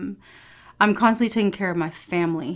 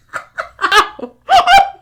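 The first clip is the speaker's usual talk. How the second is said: loud laugh; 13 dB louder than usual. Real, not acted. Hysterical laughter at story.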